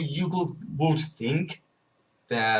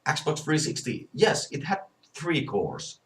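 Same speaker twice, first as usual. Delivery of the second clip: ordinary talk, nothing marked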